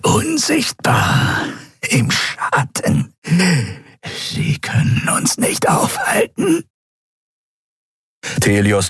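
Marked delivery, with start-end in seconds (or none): 0.0-6.6 high, breathless hiss; eerie whispered threat; hissing creature. A narrator's reading. Prisoner hisses threats.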